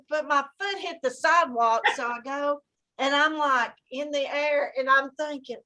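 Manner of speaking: normal talking voice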